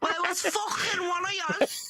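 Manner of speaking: high, shouted Scottish mother; hammed-up Scottish scolding; Scottish mom. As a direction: snap back as an angry Scottish mom. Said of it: "then the mom goes".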